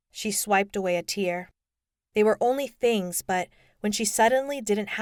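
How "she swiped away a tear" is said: ordinary delivery, nothing marked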